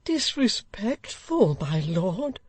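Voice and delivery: slow, high, incredulous repeat; indignant echo. Acted. Old gentleman echoing the Lord Mayor, indignant and slow. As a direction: throw the word back slowly.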